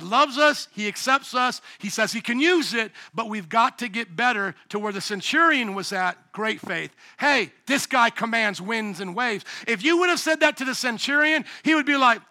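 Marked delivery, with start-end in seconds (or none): none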